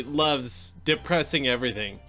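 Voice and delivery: ordinary talk, nothing marked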